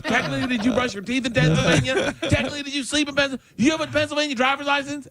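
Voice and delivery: very high, squealing cross-examination; mock interrogation. Colleague grilling Kyle about Pennsylvania, mock outrage. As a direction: pile on outraged questions in a shriek-high voice.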